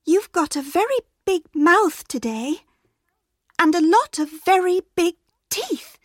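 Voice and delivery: lightly voiced small girl; girl's voice. A narrator's reading. Girl noticing grandma looks strange, lightly high.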